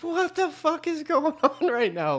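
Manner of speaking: high, slow incredulous squeal with a laugh; baffled disbelief. Retold or imitated. Housemates watch a lamp get smashed, imitated baffled disbelief. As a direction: act out stunned bewilderment, drawn out.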